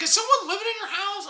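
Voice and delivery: high, fast startled question; a hint of a shocked agent; surprised agent. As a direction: mimic the startled realtor.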